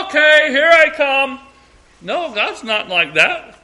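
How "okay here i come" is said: high, loud shouted reply; calling back. Acted. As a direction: holler back to your child. Father calling back to child.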